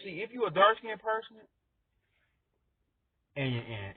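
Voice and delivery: ordinary talk, nothing marked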